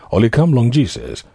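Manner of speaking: usual speaking voice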